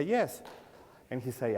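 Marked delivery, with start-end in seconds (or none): 0.0-0.4 a high, pleased yes; proud yes. Retold or imitated. To the stranger admiring his Corvette, a proud high yes. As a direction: answer like you own the place.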